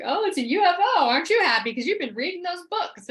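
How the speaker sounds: high, loud sing-song teasing; mocking boyfriend. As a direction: imitate a teasing, mock-thrilled partner. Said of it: "he was mocking me".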